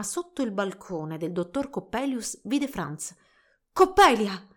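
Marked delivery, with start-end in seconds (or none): 3.7-4.5 loud call up to someone. A narrator's reading. Young man calling to a girl on a balcony, loud. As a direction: cry out the name. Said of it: "gridava Franz".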